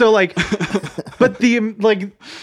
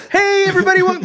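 excited intro greeting; 12 semitones higher, much faster than usual. Retold or imitated. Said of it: "I wish we could just play".

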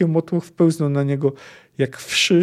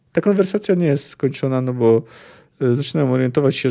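ordinary delivery

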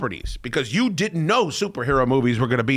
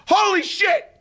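high yelled holy shit; retold scream. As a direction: shout it in shock at the TV. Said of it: "I scream".

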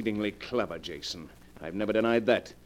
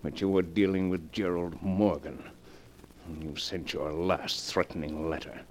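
cold murmur; 8 semitones lower, 6 dB quieter than usual. Acted. Gerald alone after the killings, muttering his plan quietly. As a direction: plot your escape under your breath.

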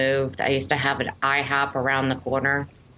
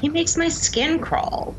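disgusted; 14 semitones higher than usual. Real, not acted. Venting disgust at Tom Cruise.

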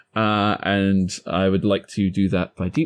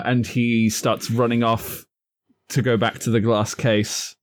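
usual speaking voice

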